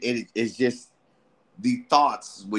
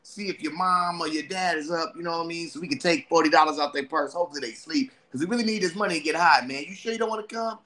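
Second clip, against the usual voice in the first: higher, loose party-friend voice; tempting homie; 8 semitones higher, faster than usual. Acted. Friend inviting him to get high, higher and loose. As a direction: lure your buddy out to get high. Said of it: "and they like".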